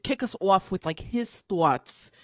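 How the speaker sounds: ordinary delivery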